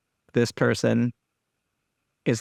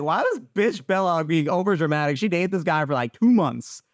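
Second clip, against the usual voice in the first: high, incredulous rant about Bella climbing even higher; mock exasperated past self; 7 semitones higher than usual. Past self ranting about New Moon, mock disbelief. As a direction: scoff at Bella's drama in a squeaky, disbelieving voice. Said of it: "and I was like".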